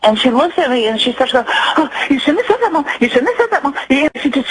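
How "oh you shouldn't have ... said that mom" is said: high, panicked pleading with a nervous laugh; frantic daughter. Retold or imitated. Teen daughter freaking out at her mother's words, high panicked voice. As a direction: voice the scared teen daughter losing it. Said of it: "she just freaked out".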